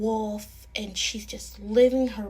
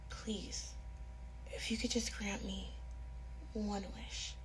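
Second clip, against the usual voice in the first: soft plea; 14 dB quieter than usual. Acted. Girl pleads with the shadow.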